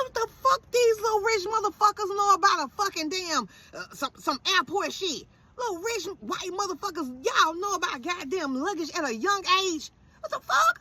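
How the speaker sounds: high-pitched, incredulous rant; mock outrage at rich kids; indignant outburst. Acts out her indignant reaction.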